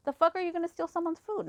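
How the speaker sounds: high-pitched indignant demand; retold angry confrontation; going off. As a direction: accuse them, pitch pushed up. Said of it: "I just went off".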